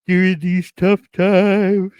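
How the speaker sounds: high, loud, slow ad-voice parody; mock ad voice. Joking about small business loans, mock commercial voice. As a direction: ham up the commercial cliché. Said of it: "mock ad phrase".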